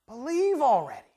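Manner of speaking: 'Believe already' called out loud and firm; loud urging. Acted. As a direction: drive it home with force. Jesus voiced, loud take.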